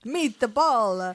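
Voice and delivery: hammed-up Italian Meatball; mock Italian, silly and over the top; badly faked Italian accent. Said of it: "It just doesn't sound like that".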